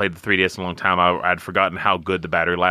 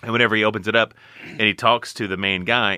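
ordinary delivery

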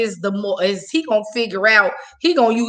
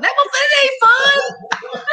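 high, slow outraged cry; indignant shout; 14 semitones higher than usual. Real, not acted. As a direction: spit it out, still furious. Angry retelling of date.

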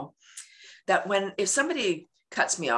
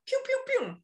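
very high, playful pew-pew; cartoonish high-pitched spurts; squirting noises; 3 dB quieter than usual. Shown as a demonstration. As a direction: imitate little squirts in a squeaky pitch. Imitates squirts of love drugs.

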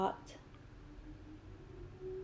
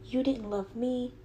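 usual speaking voice

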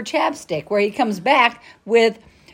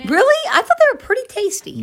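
surprised; 10 semitones higher than usual. Real, not acted. Surprised reaction to guest.

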